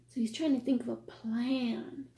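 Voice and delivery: put-on hushed whisper to the kids. Telling the children the fox's scheme, whispered. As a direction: lean in and whisper it.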